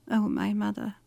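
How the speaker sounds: very soft murmur to oneself; hushed whisper. A narrator's reading. Daughter speaking to her dead mother, whispered to herself. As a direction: breathe it softly, as a private address. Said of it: "she whispered to herself".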